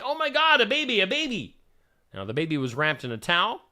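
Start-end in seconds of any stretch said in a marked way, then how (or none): 0.0-1.6 high-pitched, mildly raised startled cry; retold yell. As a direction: quote a startled shout without fully yelling. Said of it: "I yelled to my husband".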